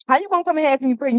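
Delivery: fast, loud, incredulous scold; quick mock telling-off; sassy scold. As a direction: rattle it off, scolding the caller.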